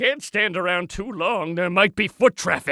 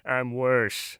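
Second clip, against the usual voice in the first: low, soft villain aside; dark, understated menace; menacing aside; 9 semitones lower, 6 dB quieter than usual. Acted. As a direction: drop low and quiet for the punchline.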